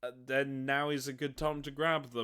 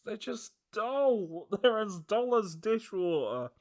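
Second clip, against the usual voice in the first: scathing critique; 8 semitones higher, 5 dB louder than usual. Real, not acted. Ranting about boring Imperial Fists.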